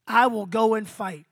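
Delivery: ordinary talk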